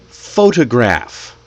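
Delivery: "photograph" slowly, stressed on PHO; first-syllable stress. Shown as a demonstration. Teacher shows learners word stress, PHO-tograph. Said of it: "we put the stress in different places".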